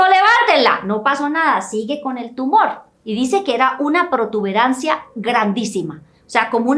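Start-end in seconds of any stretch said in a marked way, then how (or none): 0.0-0.8 higher, louder command to lift her; stern healer's loud command; commanding order. Retold or imitated. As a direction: bark the order with rising force. Preacher retells healer's command.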